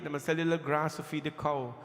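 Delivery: normal talking voice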